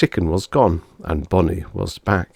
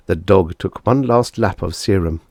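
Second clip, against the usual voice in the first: usual speaking voice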